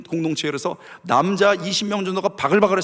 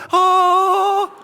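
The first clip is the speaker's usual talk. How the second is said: very high, long held "ah"; sung harp note; 13 semitones higher, 5 dB louder than usual. Acted. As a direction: belt one long comic note. Resumes playing after second dodge. (아주 높고 길게 뽑는 "아")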